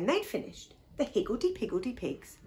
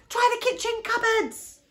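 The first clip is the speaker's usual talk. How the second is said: put-on high, loud urgent child's call; shout; 14 semitones higher, 6 dB louder than usual. A narrator's reading. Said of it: "shouted Posy".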